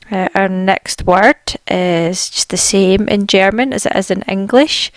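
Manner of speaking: ordinary talk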